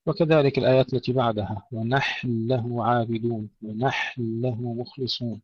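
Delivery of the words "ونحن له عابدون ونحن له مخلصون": slow "ونحن له", breathed vowelless ha into doubled lam; still ha, merged lam. Shown as a demonstration. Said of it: "نحافظ على سكون الحاء".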